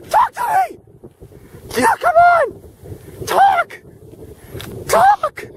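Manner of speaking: very high, hammed-up screech; desperate high shriek. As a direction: screech the plea far above normal pitch. Desperate pleading with ducks.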